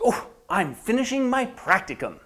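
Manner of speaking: high, breezy cover story; brightly faked confidence; outside voice. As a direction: put on a cheerful public front. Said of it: "the outside voice says".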